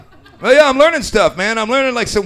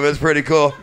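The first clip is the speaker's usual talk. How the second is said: ordinary delivery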